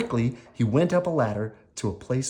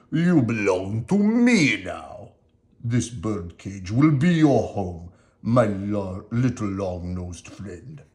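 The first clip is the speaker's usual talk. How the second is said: slow, louder villain voice fading to a sneer; gloating Stromboli; 3 dB louder, slower than usual. Acted. Stromboli locking Pinocchio in the birdcage, slow and gloating. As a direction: claim the puppet as your property, slow and loud. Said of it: "said Stromboli".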